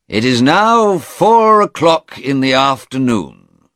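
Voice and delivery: high, loud, formal announcement; judge addressing court. A narrator's reading. Judge announces end of court day.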